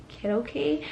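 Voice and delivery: small, soft, embarrassed question; retold sheepish asking, voice dropped in volume. Mother asking after her baby in labor, embarrassed. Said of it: "I got embarrassed and I was like".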